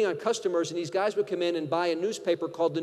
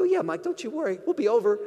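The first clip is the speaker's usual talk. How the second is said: higher-pitched, put-on promise; mock reassurance; 4 semitones higher than usual. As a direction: reassure him with knowing, playful insincerity. Fake bookie falsely reassures bettor.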